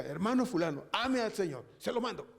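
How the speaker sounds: stern pastoral decree; commanding order. Shown as a demonstration. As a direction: order him to love the Lord. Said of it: "Usted necesitará que yo le diga".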